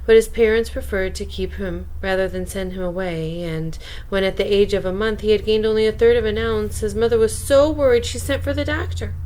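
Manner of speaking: ordinary delivery, nothing marked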